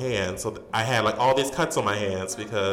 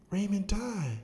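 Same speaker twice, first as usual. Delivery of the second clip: imitated aunt, softer and higher-pitched; hushed repeat; 8 semitones higher, 5 dB quieter, slower than usual. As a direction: repeat it high and hushed, to make it understood.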